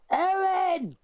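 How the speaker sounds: very high, far-off-your-own screech of a name; Anne Ramsey. Acted. Doing an impression for the panel, high-pitched Anne Ramsey call. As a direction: yell it in a high, put-on character voice. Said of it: "do my Anne Ramsey voice".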